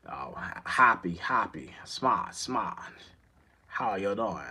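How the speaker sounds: low, slow attempt at a Boston accent. Shown as a demonstration. Showing how they don't really hear it, tentative Boston accent. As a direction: try a hammy Boston accent, drawn out. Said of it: "with that Boston accent".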